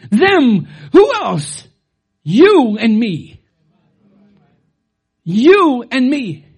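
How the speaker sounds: loud, slow, ringing declaration; preaching at full force. Real, not acted. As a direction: drive it home to the congregation. Preacher building to climax.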